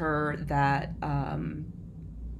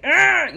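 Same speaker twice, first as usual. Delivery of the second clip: put-on loud, high frustrated toddler growl; mock tantrum growl; 10 semitones higher, 10 dB louder than usual. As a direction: growl like a four-year-old mid-tantrum. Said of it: "having a temper tantrum".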